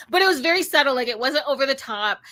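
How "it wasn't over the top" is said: ordinary talk, nothing marked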